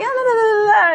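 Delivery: high, loud mock ranting; crazy-lady babble. Driver rants at her broken car, high crazed babble. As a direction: babble wildly, imitating a frantic rant. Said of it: "fucking crazy lady".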